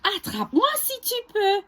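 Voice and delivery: high, fast singsong taunt; quick childlike taunt; galette taunt. Acted. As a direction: tease them as she runs.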